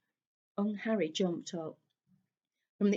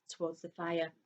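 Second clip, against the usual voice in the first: usual speaking voice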